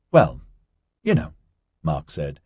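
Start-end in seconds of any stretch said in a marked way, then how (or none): 0.1-1.3 understated, modest pride; secretly proud. A narrator's reading. Mark shrugging off praise of his flight, secretly proud. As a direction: play it down with a hint of pride. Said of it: "tone that hinted at ... of secret pride".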